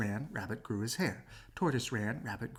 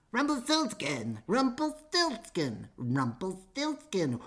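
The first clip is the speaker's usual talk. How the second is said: mock screaming; 7 semitones higher, slower than usual.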